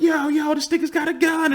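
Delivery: high, urgent alarm; panicked kids. Retold or imitated. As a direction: warn everyone as you run past. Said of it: "And they were like".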